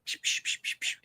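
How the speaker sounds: quick soft unvoiced "psh" bursts; imitated gunshots. Streamer plays at Duck Hunt while moderating, imitated shots. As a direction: mouth the shots of a toy light gun.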